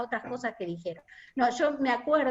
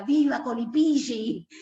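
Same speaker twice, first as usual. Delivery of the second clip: mildly raised, mock rallying cry; retold yell, a little louder; imitated shout; 5 dB louder than usual. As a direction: call it out like a cheer, half-hearted shout. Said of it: "Marcelo por ahí grita".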